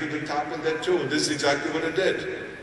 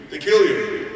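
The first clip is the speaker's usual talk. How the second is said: mock warning; 4 semitones higher, 6 dB louder than usual. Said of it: "they said the same thing".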